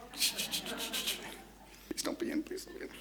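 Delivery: urgent whisper to oneself; whispered pleading. Acted. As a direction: wish under your breath while it rings. Caller secretly hoping during dialling.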